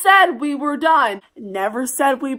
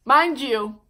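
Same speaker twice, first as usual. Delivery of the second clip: ordinary talk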